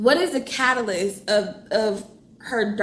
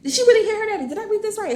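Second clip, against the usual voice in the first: much higher, astonished questions; stunned disbelief; 10 semitones higher, much faster than usual. Real, not acted. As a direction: gasp it out in disbelief. Reader stunned by slap.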